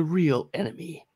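dramatic announcer voice; trailer voice. Pretend. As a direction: deliver it as the trailer twist. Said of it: "trailer voice".

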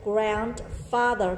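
grandfather said very slowly, falling at the end; teacher modelling grandfather slowly; slow model word. Shown as a demonstration. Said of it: "thêm chữ grand".

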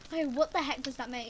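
usual speaking voice

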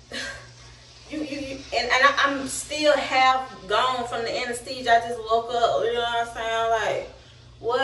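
usual speaking voice

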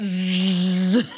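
low, steady vibrating zzz; imitated buzzing. Friend retelling walking off with a vibrating suitcase, imitated buzzing. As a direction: buzz like the toy in the bag. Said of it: "Walked off like".

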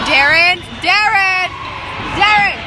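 high, loud call across the arena; calling out a name. Real, not acted. Friend in the stands calling out to Darren, loud and high. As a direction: yell his name to get his attention.

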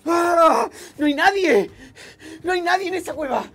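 shocked shouting. Acted. La cueva está vacía.

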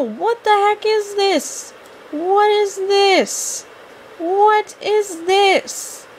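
high, drawn-out cry of disbelief; appalled disbelief. Real, not acted. Gamer reacting to a creepy outfit in the game, appalled. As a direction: stretch each word out high, aghast.

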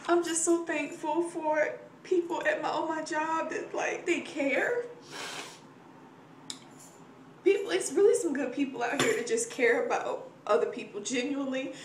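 grateful words pushed through welling tears; teary gratitude. Real, not acted. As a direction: speak your thanks while fighting back real tears. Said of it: "I feel like I'm gonna cry".

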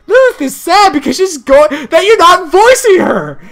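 high, loud indignant rant; acted angry scolding. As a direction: scold with hammed-up outrage. Acted scolding for not voicing Lilith.

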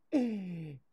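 high, falling laugh. Real, not acted. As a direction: crack up after a silly impression. Cracks up after own impression.

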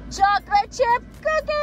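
high, loud delighted shout; excited cheer. Real, not acted. Mom announcing the cookies for Daddy to her toddler, delighted. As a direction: burst out with it like a treat.

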